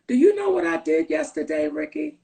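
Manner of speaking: normal talking voice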